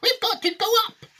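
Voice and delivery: very high, insistent shout; yelling back. A narrator's reading. Wonka overruling the panicking grandparents, high yell. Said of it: "Mister Wonka yelled back".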